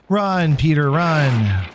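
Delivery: high, drawn-out call to flee; urging call. Pretend. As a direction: cheer the character on to escape. Urging game character to flee.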